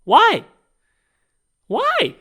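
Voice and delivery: high, loud, exasperated cry; exasperated why. Real, not acted. Fed up with influencer skits, crying out in disbelief. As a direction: plead in disbelief. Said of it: "exasperated rant".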